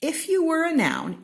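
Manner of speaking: high, loud announcing refrain; bright proclaiming refrain; excited refrain. A narrator's reading. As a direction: call it out like a title.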